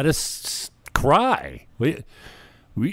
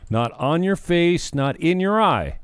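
mocking sing-song; 8 semitones higher, 3 dB louder, slower than usual. Mocking the tearless crying clip.

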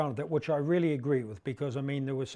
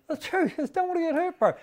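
laughing squeaky quote; 13 semitones higher, 3 dB louder than usual. Retold or imitated.

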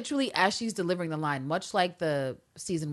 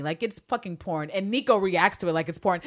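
ordinary talk

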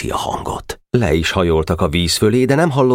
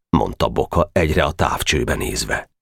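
ordinary delivery, nothing marked

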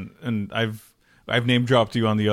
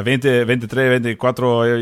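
mimicking him counting in Spanish, slow and louder; rattling off Spanish numbers; 6 dB louder than usual. Host imitating guest counting off in Spanish, mock-rapid. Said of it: "you were just going off".

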